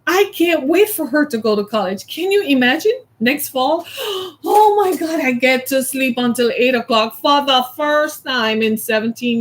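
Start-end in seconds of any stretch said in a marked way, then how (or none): 3.9-5.2 thrilled. Real, not acted. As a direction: burst out with sudden joy. Imagining the freedom ahead.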